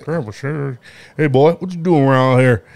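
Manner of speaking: drawling cowboy with a lip full of tobacco; old Western cowboy, lip packed; tobacco-chewing cowboy. Acted. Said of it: "like a tobacco, uh, lip packing, uh, cowboy".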